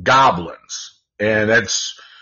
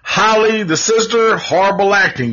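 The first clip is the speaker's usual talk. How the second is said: higher announcer voice handing out prizes; mock award announcer; 8 semitones higher than usual. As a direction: call each name like an awards host.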